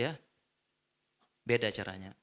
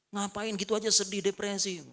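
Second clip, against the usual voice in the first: mocking dismissal; 8 semitones higher than usual. Menirukan teman yang menyindir.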